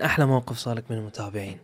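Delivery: ordinary delivery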